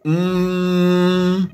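long, level "mmm"; softly held thinking noise; hesitant hum. A narrator's reading. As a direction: stall with a drawn-out hum. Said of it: "چند تا پلک زد و گفت".

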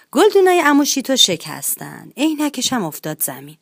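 ordinary delivery, nothing marked

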